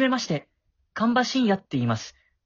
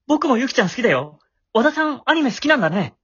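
louder and fast, rushing with excitement; eager blurting; 5 semitones higher, 6 dB louder, faster than usual. Acted. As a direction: blurt it out, too eager to hold back. Excited boy blurts out reply. (興奮して早口で大きな声)

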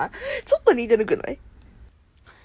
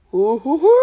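Shin-chan impression; 6 dB louder than usual. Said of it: "やったことないモノマネは無理やったわ".

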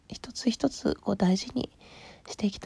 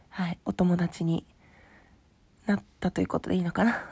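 ordinary delivery

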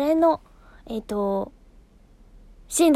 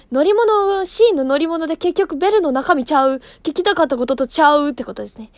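louder Kansai-style correction; loud tsukkomi on her own tangent; self retort; 7 dB louder than usual. Real, not acted. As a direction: snap back at herself, comedy retort.

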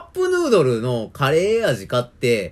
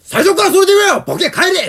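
shouting, much higher and louder; mock furious yell; 10 dB louder than usual. Clerk imagining he yells at a mumbling customer, mock rage. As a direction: tell him off and send him packing.

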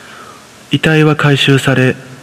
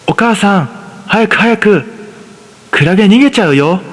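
put-on higher, eager child voice; higher-pitched excited young son; little boy voice; 8 semitones higher than usual. A narrator's reading. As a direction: voice a five-year-old begging his mother. Son runs ahead excited.

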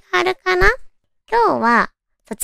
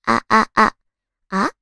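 hoarse voice; 2 semitones lower than usual. Real, not acted. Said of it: "まだちょっとガラガラだけど".

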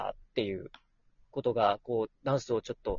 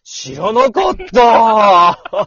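exaggerated surprise; 8 semitones higher, 15 dB louder, much slower than usual. Mock-shocked reply in banter.

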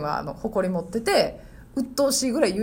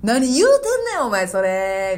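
周りに聞かせる大声; 5 dB louder than usual. Retold or imitated. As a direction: imitate a student performing a comeback for everyone nearby. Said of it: "絶対に周りを意識した音量で". (周りを意識した大声のツッコミ)

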